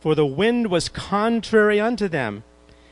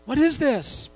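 panicked disciples; 9 semitones higher, slower than usual. Retold or imitated. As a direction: beg the Lord in a sinking boat. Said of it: "They said".

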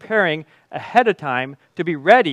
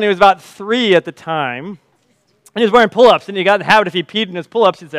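normal talking voice

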